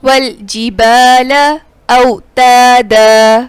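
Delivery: slow chanted والجبال اوتادا; measured, drawn-out recitation; tajweed recitation. Shown as a demonstration. Teacher reciting for students to repeat, slow chant.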